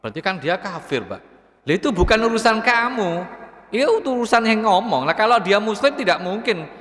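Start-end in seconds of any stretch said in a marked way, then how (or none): 1.7-6.7 higher, insistent reply; emphatic rebuttal. Real, not acted. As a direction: push back firmly. Preacher rebuts congregant.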